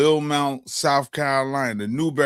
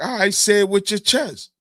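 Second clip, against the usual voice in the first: hyped-up urging; 7 semitones higher than usual. Retold or imitated. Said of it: "He's like".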